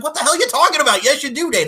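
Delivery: high, loud and fast hyped-up friend; loud, fast mock pep talk to a shy friend; excited pep talk. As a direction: pump her up, cheering her on. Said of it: "And she's like".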